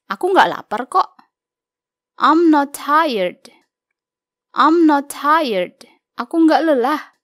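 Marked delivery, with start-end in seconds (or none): 2.2-3.5 slow model reading. Shown as a demonstration. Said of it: "Yuk mulai dengan membaca". 4.5-5.8 slow model reading. Shown as a demonstration. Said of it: "Yuk mulai dengan membaca".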